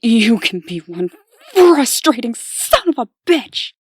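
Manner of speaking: loud, high exasperated yell; furious shout. Acted. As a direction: blow up at him in frustration. Exasperated outburst at partner.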